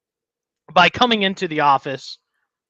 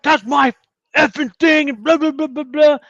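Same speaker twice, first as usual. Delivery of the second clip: high-pitched imitation of an irate complainer; mock angry rant; 8 semitones higher than usual. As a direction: rant it high, trailing into mocking blahs. Retold angry man ranting.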